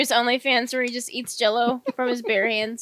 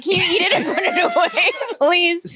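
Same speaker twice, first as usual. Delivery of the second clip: laughing; 5 semitones higher, 4 dB louder than usual. Real, not acted. Grossed out by Drew.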